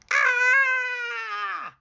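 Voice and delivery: long, piercingly high storybook scream; high-pitched scream. Acted. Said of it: "and screamed".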